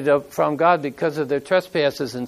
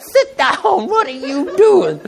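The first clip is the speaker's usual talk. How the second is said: laughing retelling; 11 semitones higher, slower than usual. Retold or imitated. Husband restraining wife at concert.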